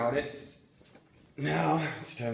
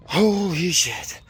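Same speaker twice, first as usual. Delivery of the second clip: exhausted exclamation; 8 semitones higher, 9 dB louder, slower than usual. Real, not acted. Finishing a hard run.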